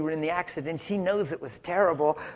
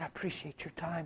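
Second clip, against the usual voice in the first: very soft, gentle gratitude; real gentle; 17 dB quieter than usual. Retold or imitated. Retelling his word to the cops for their time, real gentle. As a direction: say it quietly and kindly. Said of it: "I looked at him real gentle".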